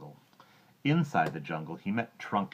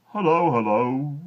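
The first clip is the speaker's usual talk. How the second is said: slow, higher, louder hippo hello; hippo greeting; 9 semitones higher, 12 dB louder, slower than usual. Acted. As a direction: greet the croc slowly and heartily. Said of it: "said Humpy Rumpy".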